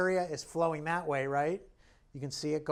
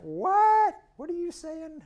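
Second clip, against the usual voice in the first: much higher, indignant "What?"; voicing the painted woman; 9 dB louder, slower than usual. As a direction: squeak out her offended disbelief. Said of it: "She almost looks like she's doing that".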